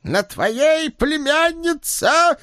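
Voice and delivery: very high, slow shouted cry; bold shout. Acted. Ed shouting bravely to the niece, very high and slow. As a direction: cry it out bravely, drawing out племянница. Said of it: "храбро выкрикнул бывший бог".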